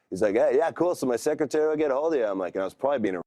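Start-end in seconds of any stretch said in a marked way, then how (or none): 0.3-2.2 higher, fast, breezy phone sign-off; higher, quick offhand brush-off; breezy caller. Retold or imitated. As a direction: rattle it off like a busy recruiter. WWE caller wrapping up call.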